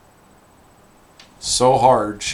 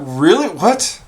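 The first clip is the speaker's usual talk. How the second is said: higher, louder startled "really?"; surprised disbelief; 8 semitones higher, 8 dB louder, slower than usual. Retold or imitated. Owner hears the dog totaled the car, startled and loud. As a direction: caught off guard, blurting out disbelief. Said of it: "I'm like".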